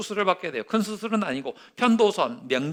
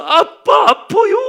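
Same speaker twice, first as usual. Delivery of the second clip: crying out; 11 dB louder, much slower than usual. Retold or imitated. Boy crying out to father.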